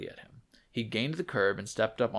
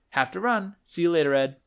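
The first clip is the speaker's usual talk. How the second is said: higher, louder rushed farewell; hurried goodbye; 8 semitones higher, 7 dB louder than usual. Acted. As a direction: toss it off as you dash away. Potter hurrying away.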